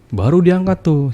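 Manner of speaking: normal talking voice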